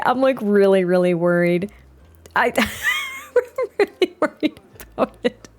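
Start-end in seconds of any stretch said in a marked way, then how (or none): 2.4-5.5 high, laughing through the words; nervous laughing. Real, not acted. Player fretting over the awkward introductions, nervous laughing. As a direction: break into helpless laughter while admitting worry.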